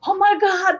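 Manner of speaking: high-pitched amazed exclamation; delighted surprise. Retold or imitated. Speaker recalls hearing childhood sayings at the conference, exclaiming in high surprise. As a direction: relive the amazement, pitch jumping up.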